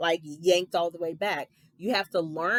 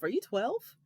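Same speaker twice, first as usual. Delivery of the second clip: ordinary talk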